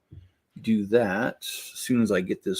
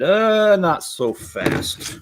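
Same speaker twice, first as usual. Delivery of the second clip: mock dismissive official; 8 semitones higher, 4 dB louder, much slower than usual. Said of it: "our city said".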